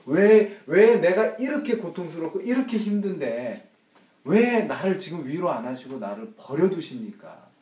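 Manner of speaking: loud, high desperate why; the forsaken son shouting his why; anguished cry. Acted. Jesus's cry voiced by preacher.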